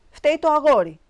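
loud, high blaming outburst; indignant accusation. A narrator's reading. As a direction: throw the blame loudly at the boy. Dog owner angrily blames the boy. (δυνατή, αγανακτισμένη κατηγορία)

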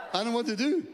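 flustered laugh. Retold or imitated. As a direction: laugh out your panic.

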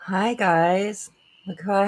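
high, loud laughing burst of excitement; bursting with joy at going live; so excited. Real, not acted. Streamer greeting her returning viewers, giddy and loud. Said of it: "I'm so excited".

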